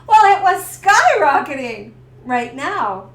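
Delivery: loud, slow, drawn-out emphasis; skyrocketing stress. Retold or imitated. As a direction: stretch it out loudly for drama. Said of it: "my stress level was out of control".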